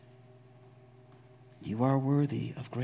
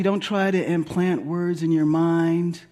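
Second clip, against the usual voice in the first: usual speaking voice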